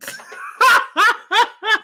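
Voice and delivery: high real laugh breaking off the list; can't hold it in. Real, not acted. Laughing at number seven.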